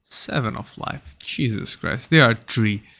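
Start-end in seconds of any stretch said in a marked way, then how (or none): none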